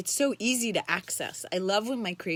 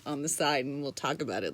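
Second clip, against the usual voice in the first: ordinary talk, nothing marked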